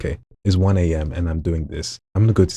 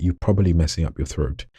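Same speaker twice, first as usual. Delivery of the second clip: normal talking voice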